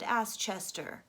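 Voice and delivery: ordinary delivery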